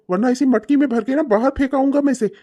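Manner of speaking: usual speaking voice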